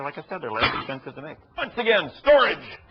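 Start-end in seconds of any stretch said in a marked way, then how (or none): 1.6-2.8 excited exclamation. Real, not acted. Joking about his junk pile.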